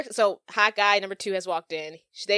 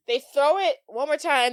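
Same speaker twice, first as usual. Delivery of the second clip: usual speaking voice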